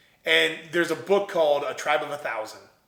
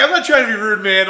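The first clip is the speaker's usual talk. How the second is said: louder, higher, laughing as he says it; laughing apology; 5 semitones higher, 10 dB louder than usual. Retold or imitated. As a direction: laugh it off while pushing him away. Laughing, brushing off the stranger.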